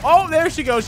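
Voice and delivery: high startled outburst; startled streamer. Real, not acted. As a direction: caught off guard. Chica jumping on table.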